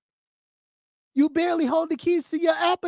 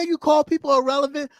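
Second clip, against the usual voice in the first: normal talking voice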